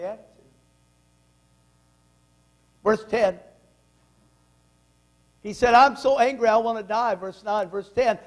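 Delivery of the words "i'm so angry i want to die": angry. Acted. Jonah's angry complaint quoted.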